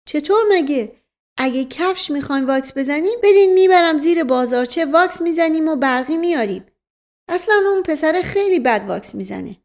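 high, loud-ish servant voice; high comic servant character; guileless servant. Acted. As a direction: answer the master in a high-pitched, earnest voice. Servant figure rambles on.

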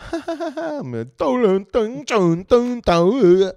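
high, loud, drawn-out mock Chinese accent. Comic showing his stage bit, hammed-up mock Chinese accent. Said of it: "I'll be doing a Chinese accent".

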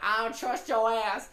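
loud, high, drawn-out distrust; hammed-up scared refusal; mock fear. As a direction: act too frightened to go along. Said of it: "act like I was just afraid".

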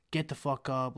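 usual speaking voice

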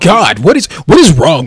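exasperated yelling. Acted. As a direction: yell at him in disbelief. Snapping at the sidekick.

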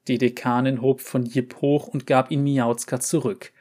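ordinary delivery, nothing marked

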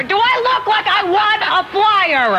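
slow, high, exasperated retort; drawn-out indignant disbelief; annoyed retort. Retold or imitated. Annoyed retort to flyer people.

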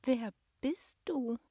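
high, slow, hesitant question; unsure. A narrator's reading. Gnome girl asks the unknown monster, unsure and hesitant. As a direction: feel your way through the question. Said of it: "fragte sie nach einer Weile unsicher".

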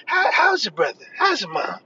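high, eager catch-up questions; mock gushing. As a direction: imitate someone overdoing friendly concern, pitching way up. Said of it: "they're like".

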